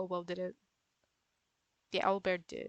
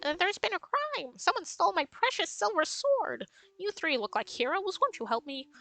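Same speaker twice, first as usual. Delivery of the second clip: high, eager little mouse pleading with heroes; squeaky mouse voice; 4 semitones higher than usual. Acted. Mouse begging the heroes to find her stolen sword, high and squeaky. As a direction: pitch way up as a frantic tiny mouse.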